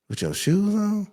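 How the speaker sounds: high, disbelieving question; can't believe it; incredulous. Real, not acted. Asking a guest about shoes in bed, high and disbelieving.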